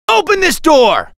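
loud, high angry shout; enraged high-pitched command; furious demand. Acted. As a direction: yell to be let in right now. Hero demands his wife back.

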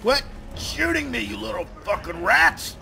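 high-pitched angry protest; shrieky irritation at the attackers; exasperated rant. Real, not acted. Being shot by enemies.

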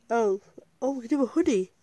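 usual speaking voice

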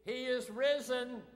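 loud, high call pushing for a response. Shown as a demonstration. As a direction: rouse them with a big shout. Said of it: "That's mighty weak".

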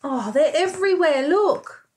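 ordinary talk, nothing marked

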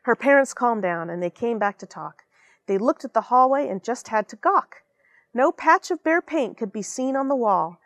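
ordinary delivery, nothing marked

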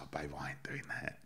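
hushed whisper, almost no voice. Retold or imitated. To his wife, brushing off the interview in a whisper. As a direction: breathe it out like a dismissive secret.

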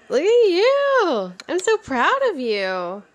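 high proud praise. Real, not acted. Praising toddler.

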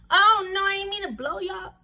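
ordinary talk

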